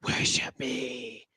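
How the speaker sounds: low, slow, drawn-out command; ominous voice in the head; commanding villainess voice. Acted. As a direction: intone it deep and very slow. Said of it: "you hear her voice".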